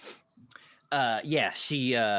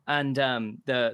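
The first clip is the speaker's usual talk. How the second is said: ordinary talk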